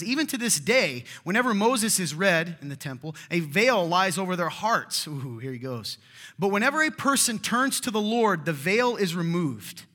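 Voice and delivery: loud, fervent scripture reading at full force. Acted. Preacher reading Second Corinthians aloud to the congregation, loud and fervent. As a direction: proclaim the passage to the congregation.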